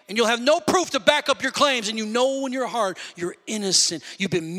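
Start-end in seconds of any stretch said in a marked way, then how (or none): none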